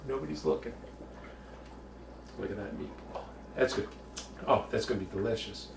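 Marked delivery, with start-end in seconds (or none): 0.1-3.2 hushed whisper. Acted. Whispering to meat, nobody's looking.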